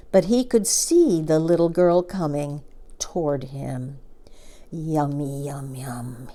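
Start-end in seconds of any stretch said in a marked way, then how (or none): 4.7-6.3 soft, hungry whispered yum yum; a crocodile licking its lips in secret; greedy whisper. A narrator's reading. Crocodile's greedy private thought.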